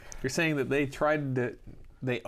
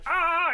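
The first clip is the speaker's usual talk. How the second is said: high laughing mock yelp; scream of agony; 12 semitones higher than usual. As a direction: imitate the pained yell while laughing. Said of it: "he's screaming for help".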